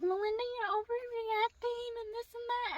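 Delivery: high-pitched mocking mom voice; imitated dismissive mom. Mom dismissing angry daughter, mocking imitation. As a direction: mimic mom brushing it off. Mom's side of retold quarrel.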